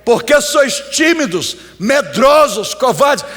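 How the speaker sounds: repreensão alta e aguda de pregador; bronca bradada. Acted. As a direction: brade como quem cobra os medrosos. Pregador encena a bronca de Jesus. (bronca de pregador em voz alta)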